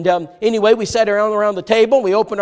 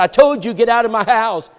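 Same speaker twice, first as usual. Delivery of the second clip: louder and higher throughout; retold furious order; 6 semitones higher, 6 dB louder than usual. Enraged father ordering the boy out, retold yell. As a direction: throw him out with your voice.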